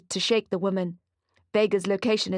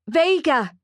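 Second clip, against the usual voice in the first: loud, high shouted name; shouting; 7 semitones higher, 6 dB louder than usual. Acted. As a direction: yell her name across the shop. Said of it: "Mali shouts at her".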